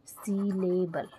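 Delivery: Syllable stretched out syllable by syllable; slow dictation. Shown as a demonstration. Teacher dictating to note-takers, syllable in slow syllables. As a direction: say syllable very slowly, each syllable separate. Teacher repeats term slowly for writing.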